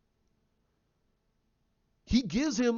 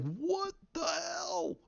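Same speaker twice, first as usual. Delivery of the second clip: stunned disbelief; 10 semitones higher, 7 dB quieter, much slower than usual. Retold or imitated. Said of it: "You're like".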